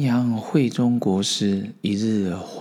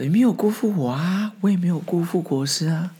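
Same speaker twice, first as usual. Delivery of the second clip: high, earnest protest from a confused servant; protesting attendant; 9 semitones higher than usual. Acted. The attendant protesting innocently to the master in a high voice. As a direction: pitch up and insist you did nothing wrong.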